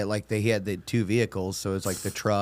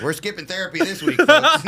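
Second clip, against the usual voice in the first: high, louder mock announcement through laughter; laughing announcement; 11 semitones higher, 7 dB louder than usual. Joking to listeners about skipping therapy, laughing. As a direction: announce it gleefully to the crowd.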